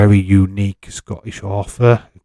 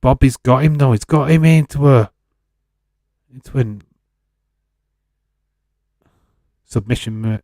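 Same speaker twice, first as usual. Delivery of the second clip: wrestling commentary; 4 dB louder than usual. Pretend. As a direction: call the submission drama like a ringside announcer.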